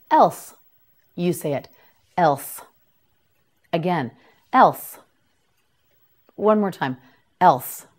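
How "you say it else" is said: normal talking voice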